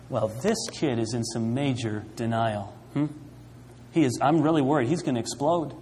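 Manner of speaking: low, hushed voice of a worried counselor; soft, low, acted worry; mock grave concern. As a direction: murmur a grave diagnosis, imitating concern. Mimics the group's grave verdict.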